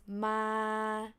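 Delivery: "ma" long and level at normal pitch; mid tone. Shown as a demonstration. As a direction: hold "ma" straight in mid pitch. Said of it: "mid pitch".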